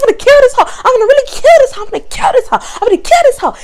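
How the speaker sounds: high, loud imitated threat; mock threat. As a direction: ham up the coworker's fury. Coworker keeps threatening, retold.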